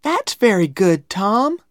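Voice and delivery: put-on high, loud girlish praise; admiring little girl. A narrator's reading. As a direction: praise him in a high girl's voice. Becky praises Tom's drawing.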